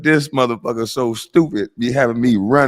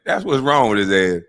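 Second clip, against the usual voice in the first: normal talking voice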